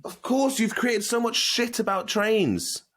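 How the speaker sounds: high, excited of course; delighted realisation. Real, not acted. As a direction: it all clicks for her, real delight. Realises the link to trains.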